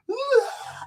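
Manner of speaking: put-on very high short baby cry; dad mimicking baby's cry, very high; imitated baby wail.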